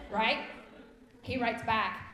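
usual speaking voice

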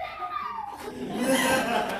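put-on very high panicked kid squeal; the kid answering the angry group. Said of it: "And I was like".

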